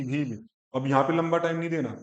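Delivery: ordinary delivery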